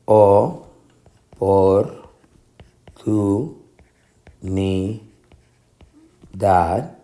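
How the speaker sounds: o, por, tu, ni, dad, each alone; long pauses between O por tu ni dad; divided into syllables. Shown as a demonstration. Teacher dividing the last word, o-por-tu-ni-dad in separate syllables. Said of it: "la sílaba tónica".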